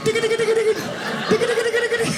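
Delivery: high frantic cartoon dog gibberish; Scooby-Doo scared babble. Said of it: "like Shaggy and Scoob".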